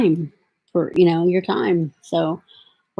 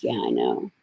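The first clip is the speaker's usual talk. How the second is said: much lower, soft weary sigh; tired resignation; 7 dB quieter than usual. Retold or imitated. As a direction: answer your daughter wearily. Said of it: "And I'm like".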